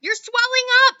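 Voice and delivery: mother screams as Violet turns blue; screaming mother. Acted. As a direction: draw the scream out in horror. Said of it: "screamed Missus Beauregard again".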